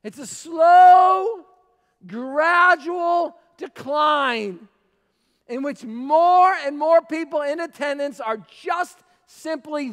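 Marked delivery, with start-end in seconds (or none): 0.3-4.7 slow, drawn-out preacher's shout. Real, not acted. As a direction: bellow it slowly, word by word. Preacher hammering home a point.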